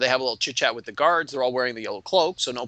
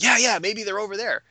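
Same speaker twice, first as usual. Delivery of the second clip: eager; 7 semitones higher than usual. Retold or imitated. Players eagerly seize a lead.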